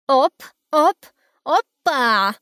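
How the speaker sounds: loud, high excited chant; bouncy dance-floor hype; Chrum dancing. Acted. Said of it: "приговаривал Хрум".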